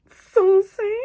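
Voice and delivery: high, loud, drawn-out questioning squeal; saucy high-pitched exclamation; playful squeal. Real, not acted. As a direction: sing it out high and cheeky. Playful squeal at a glitter name.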